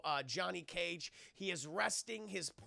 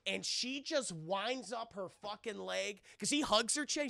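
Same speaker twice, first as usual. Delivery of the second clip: normal talking voice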